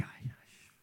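breathless whispered groan; dismayed whisper. Retold or imitated. Pastor recalling the landlady's eviction news, whispered dismay.

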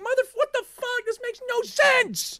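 very high, strained comic rage; exasperated outburst. Retold or imitated. Player to best friend after the worst ending, high exasperated screech. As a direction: screech it in disbelieving frustration.

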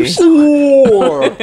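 You swore said high and loud with hammed-up R sounds; exaggerated R's. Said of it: "your R's are crazy".

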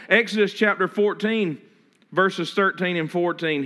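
ordinary talk, nothing marked

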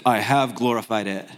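lightly voiced heavenly voice, low; God the Father. The Father answers Jesus from heaven, lightly voiced low reply. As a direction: drop low for a hint of divine reply. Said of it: "The Father speaks. A voice from heaven".

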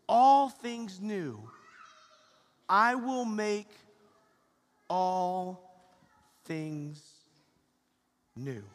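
slow, weighty scripture quote; solemn quote. Acted. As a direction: let each word land. Quoting scripture slowly, with weight.

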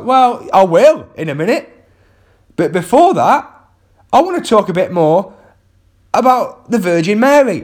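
defiant comeback. Retold or imitated. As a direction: push back at the heckler with mock stubbornness. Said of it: "I was like".

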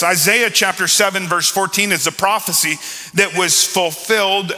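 preaching. Real, not acted. Preacher proclaiming Isaiah's prophecy to the congregation, declaiming.

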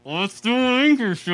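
through clenched teeth. Shown as a demonstration. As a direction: jaw shut tight while speaking. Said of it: "with your teeth closed".

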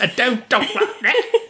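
very high, laughing fake English accent; botched Cary Grant accent. Shown as a demonstration. Host failing at the Cary Grant voice, high laughing English accent. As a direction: squeal it in a badly faked Cockney accent. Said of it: "I can't do the voice".

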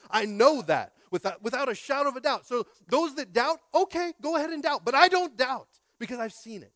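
loud, high preaching build; full-force preaching. Acted. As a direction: preach it with rising fire.

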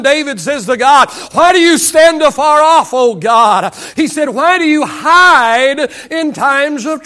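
full-force pulpit delivery with acted quotes; driving sermon cadence; impassioned preaching. Real, not acted. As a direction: preach it out, crying aloud to God.

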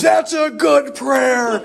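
high, slow, laughing imitation of the old priest; mock high drawn-out reply. Old priest answering the rebellious young man, high and slow. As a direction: stretch it out high and amused. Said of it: "And he goes".

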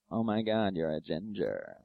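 low, drawn-out imitation of a flirt; mock smitten admirer. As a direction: swoon it slowly, pitched low. Voicing an online dating admirer.

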